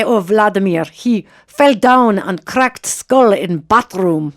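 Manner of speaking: slow, hammed-up Russian accent; bad Russian accent. Podcast host mimicking Russians explaining away Putin's fall, bad Russian accent. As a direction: do a terrible mock Russian accent, drawn out. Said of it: "the worst Russian accent in the history of mankind".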